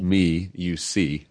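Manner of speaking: ME, YOU, SEE very slow, each word apart; split-word wordplay. Shown as a demonstration. Husband breaks intimacy into words, slow and separated. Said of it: "the definition some have used of intimacy is".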